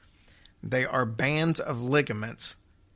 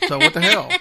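laughing; 9 dB louder than usual. Real, not acted. Joking punchline with a laugh.

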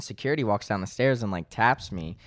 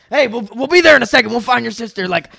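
high, loud, fast owner hype; high loud sing-song hype; hyped shout; 13 semitones higher, 11 dB louder than usual. Retold or imitated.

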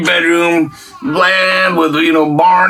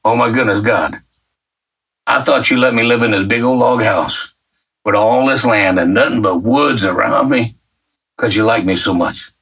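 awed prayer; 7 semitones lower than usual. Retold or imitated. Realizing why God moved him there, hushed and low.

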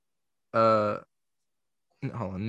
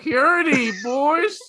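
high, loud hammed-up Texan greeting; mock Texan accent; 7 dB louder, much slower than usual. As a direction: ham up a Texan barbecue welcome. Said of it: "they're gonna be like".

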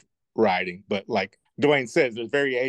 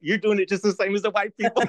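much higher, mock-indignant retort; amused protest; 10 semitones higher, 3 dB louder than usual. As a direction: call them out in a high voice, about to laugh. Quoting own protest, laughing after.

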